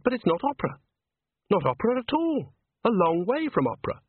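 high-pitched, delighted insistence; thrilled, pitch leaping up; excited. Acted. Andre thrilled it is not opera.

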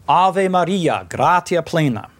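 Ave Maria, gratia plena in church Latin vowels; Latin phrase. Shown as a demonstration. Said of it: "a pretty much literal translation of the Latin".

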